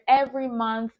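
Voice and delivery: ordinary talk, nothing marked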